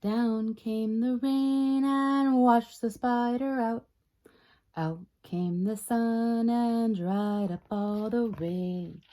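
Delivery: ordinary delivery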